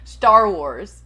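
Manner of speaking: loud American "Star Wars", R fully rolled in "Star"; American accent. Shown as a demonstration. Host proving she can say "Star Wars" American-style, loud. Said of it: "Star Wars in an American accent".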